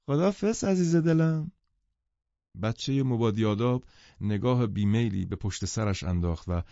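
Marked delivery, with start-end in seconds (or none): 0.1-1.5 high, cooing farewell; doting mother. A narrator's reading. Mother saying goodbye to her child, cooing. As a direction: send the little one off sweetly.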